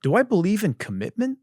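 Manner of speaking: a hint of a self-searching viewer; mock self-questioning. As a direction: ask yourself like a solemn doubter. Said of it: "you're gonna say".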